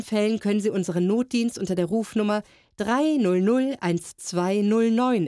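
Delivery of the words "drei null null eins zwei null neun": slow and clear phone number; slow number dictation. Acted. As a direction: spell out digits slowly.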